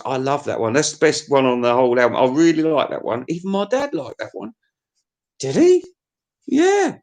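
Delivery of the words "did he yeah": high mock voice; squeaky puppet voice; high squeak. High squeaky back-and-forth.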